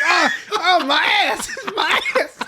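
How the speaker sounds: very high, loud cry with laughter; boy in pain. Retold or imitated. Boy crying out as fire shoots from him, very high and loud. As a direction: yelp in mock agony. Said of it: "He's like".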